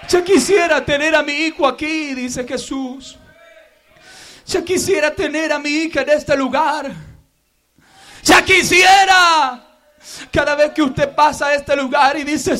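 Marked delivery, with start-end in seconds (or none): 0.1-9.6 tender longing. Acted. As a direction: say it gently, aching. Soft tender voice of Jesus. (voz tierna y anhelante)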